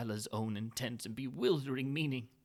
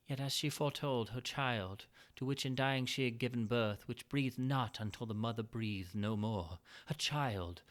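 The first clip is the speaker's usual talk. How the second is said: ordinary delivery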